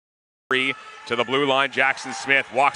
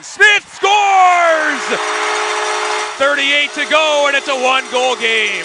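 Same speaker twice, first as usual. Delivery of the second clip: higher, louder, slow stretched goal call; long goal yell; 11 semitones higher, 5 dB louder, slower than usual. Real, not acted. Goal call in hockey play-by-play.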